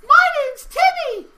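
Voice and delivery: put-on very high, shouted kid greeting; little boy voice. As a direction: squeal it like an eager tiny kid. Timmy introduces himself.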